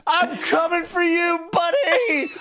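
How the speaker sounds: high-pitched comic war cry; over-the-top gleeful holler; battle cry. Acted. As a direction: yell it high, charging after someone. Acting out character charging on horseback.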